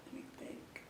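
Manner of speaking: whispered under the breath; whispering. Real, not acted. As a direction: whisper it to yourself. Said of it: "thinking aloud, repeated".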